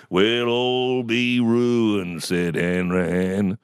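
very slow, drawn-out doom-laden recital; mum's recitation voice. Retold or imitated. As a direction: stretch every word like a theatrical prophet of ruin. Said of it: "putting on The Voice".

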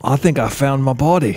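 playful fake English accent. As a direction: say it as a hammy English sleuth. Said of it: "talk in an English accent".

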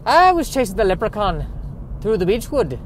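high-pitched, hammed-up bad Irish accent; terrible Irish accent. Shown as a demonstration. Host riffing on Beechwood sounding Irish, terrible Irish accent. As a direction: attempt a hopelessly fake Irish brogue. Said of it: "That was a terrible I-, a-, Irish accent".

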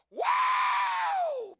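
huge high whooping holler; imitated hollering. Preacher imitating the man hollering in church, hollered whoop. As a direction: let out a long, piercing whoop. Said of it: "He's hollering".

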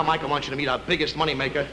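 ordinary delivery

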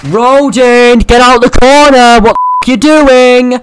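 exasperated shout; annoyed yell. Real, not acted. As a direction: bark it at the player in the corner. Yelling at enemy player.

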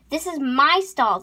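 loud, heated "This is my stall" at normal speed; indignant claim. Acted. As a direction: snap out "This is my stall" loudly.

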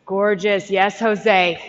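ordinary talk

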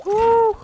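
sudden high, loud yelp; a sharp gasped cry of alarm; startled yelp. Real, not acted. Gamer yelps at a near miss, startled. Yelp while jumping in game.

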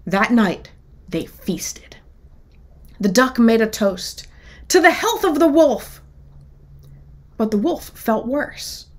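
lively picture-book read-aloud, characters voiced; children's story reading. A narrator's reading. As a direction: tell it like storytime for kids.

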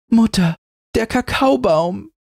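put-on very high, joyful girl's cry; overjoyed child. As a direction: burst out like a happy child. Girl wakes, relieved.

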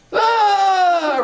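long, very high, loud wail; freaked-out scream. Retold or imitated. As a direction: scream out in sudden disgust. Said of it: "the first thing you do is go".

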